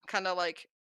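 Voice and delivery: ordinary delivery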